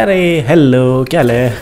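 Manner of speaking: a sly, stretched mock hello; mock greeting. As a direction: greet the guards like old pals, teasing. Greeting enemies mockingly.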